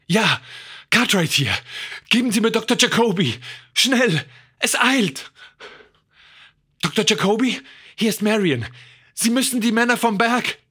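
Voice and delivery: high, pressing phone voice; hurried urgency. A narrator's reading. Marion phoning the observatory for help, urgent. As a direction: push each phrase out in high alarm. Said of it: "Marion wählte hastig die Nummer".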